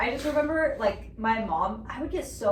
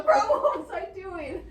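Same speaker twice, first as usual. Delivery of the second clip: laughing; 8 semitones higher, 3 dB louder, much slower than usual. Real, not acted. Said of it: "laughing at herself".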